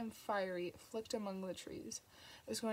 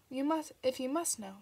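testily; 4 semitones higher, 6 dB louder than usual. A narrator's reading. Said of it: "a trifle testily".

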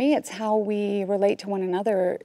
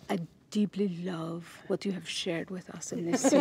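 a gentle director in a bad French Canadian accent; 6 dB quieter than usual. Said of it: "this is my bad French Canadian accent".

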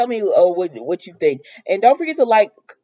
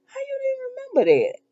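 very high-pitched disbelieving question; incredulous; 4 dB quieter than usual. Real, not acted. Disbelief that Evan forgot.

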